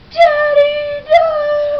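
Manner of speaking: high, loud anguished cry; young Simba screaming for his dad, hammed-up; crying out Daddy. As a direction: wail it like a child losing his father.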